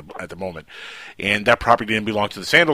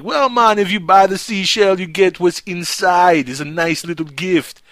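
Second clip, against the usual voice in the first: much higher, slow Jamaican sales pitch; Jamaican seller; 8 semitones higher, 4 dB louder, slower than usual. Retold or imitated. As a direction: coax them into buying a seashell. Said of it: "he's like".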